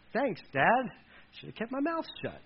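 high, half-voiced wry aside; mock sarcastic thanks. Son answering dad's poor blessing, mock sarcastic. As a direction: play the let-down son muttering lightly.

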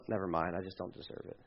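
very soft, low withdrawal; giving up. Acted. Believer abandoning a prayer to God, hushed and resigned. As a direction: let the plea sink away in resignation.

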